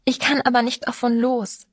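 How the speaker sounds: understated anguished outburst; blurted out. A narrator's reading. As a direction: burst out with it, mildly. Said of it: "stieß sie hervor".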